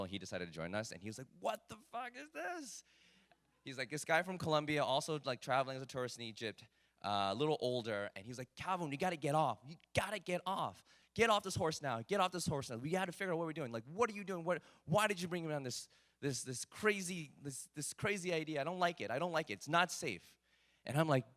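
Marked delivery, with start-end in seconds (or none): 1.4-2.8 panicked friend. Retold or imitated. Eduardo panics on the horse. 8.5-20.2 higher, pleading voice of a scared friend; a hint of a fretting father begging him to stop; worried dad-like friend. Scared friend begs rider to get off the horse, pleading. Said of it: "you sound like my dad right now".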